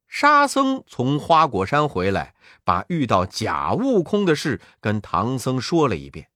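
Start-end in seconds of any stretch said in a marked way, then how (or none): none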